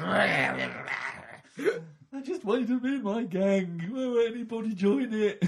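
high, loud whining sob; whiny sobbing man. Pretend. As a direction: plead through tears, high and loud. Voicing villain whining.

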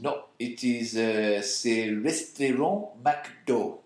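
drawn-out French pronunciation; French accent. Shown as a demonstration. Telling co-host the French name for the restaurant, French accent. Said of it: "in a French accent".

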